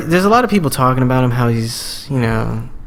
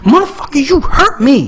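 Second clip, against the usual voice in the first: high, loud, furious yell at dad; a grown son finally yelling at his dad, furious and high; angry retort; 12 semitones higher, 4 dB louder than usual. Retold or imitated. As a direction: throw the hurt back at him, yelling. Yelling back at abusive dad.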